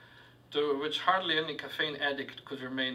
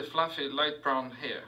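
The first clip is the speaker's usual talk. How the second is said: ordinary delivery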